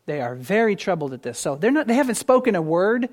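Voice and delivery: ordinary delivery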